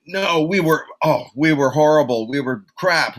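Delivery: retelling a singer's outburst, mock heated; arguing. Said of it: "[name] is saying".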